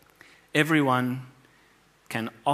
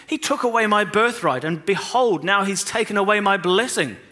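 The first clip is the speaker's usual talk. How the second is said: pleading cry; 7 semitones higher, 5 dB louder than usual. Acted. Esau begs on his knees.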